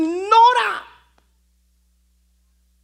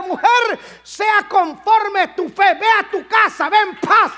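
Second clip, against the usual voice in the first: preaching at full force; 4 semitones higher than usual. Acted. Preacher declares the miracle over the woman, full-voiced shout. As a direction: proclaim it like a blessing from the pulpit.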